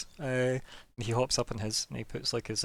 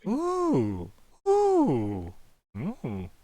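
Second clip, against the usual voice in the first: cooing ooh; 5 semitones higher, much slower than usual. Acted. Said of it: "and he goes".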